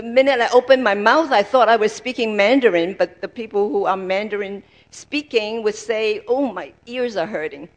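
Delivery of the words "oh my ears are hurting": ordinary talk, nothing marked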